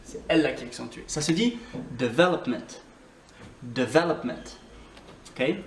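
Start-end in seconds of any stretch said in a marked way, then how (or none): 2.0-2.8 "development" with the second syllable VEL stressed. Shown as a demonstration. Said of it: "on accentue le V". 3.6-4.4 "development" with the second syllable VEL stressed. Shown as a demonstration. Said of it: "on accentue le V".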